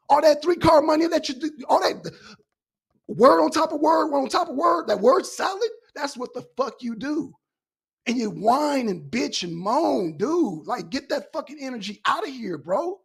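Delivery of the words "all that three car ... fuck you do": heated rant. Real, not acted. Heated rant at another panelist.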